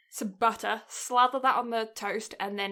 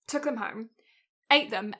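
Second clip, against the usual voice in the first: usual speaking voice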